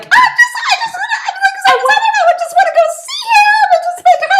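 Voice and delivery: very high, loud, fast laughing squeal; hammed-up Kayla. Mocking Kayla's eagerness to see Ben, shrieking with laughter. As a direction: squeal it like a giddy caricature. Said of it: "and she's like".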